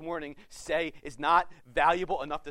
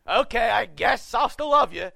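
a loud, grudging, exasperated concession; begrudging; 3 semitones higher, 11 dB louder than usual. Pretend. Preacher mocking his own grudging promise, loud and begrudging. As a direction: throw it out like you're forced to love them. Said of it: "give you this promise begrudgingly".

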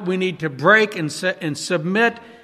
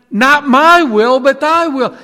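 loud, higher, slow on "my"; fervent declaration; 6 semitones higher, 8 dB louder than usual. Retold or imitated. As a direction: proclaim "Not my will" with force. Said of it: "we're saying".

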